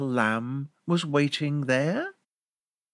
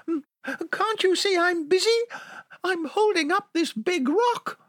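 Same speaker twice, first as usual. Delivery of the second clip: high, pleading lamb voice; little lamb tricking the wolf. Acted. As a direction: beg sweetly in a tiny high voice.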